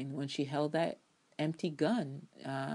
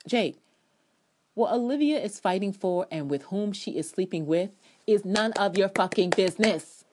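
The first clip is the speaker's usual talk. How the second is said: louder, higher telling-off; angry scolding; 4 semitones higher, 6 dB louder than usual. Real, not acted. Fan scolding Jake over Liv, louder anger. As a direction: scold him hard.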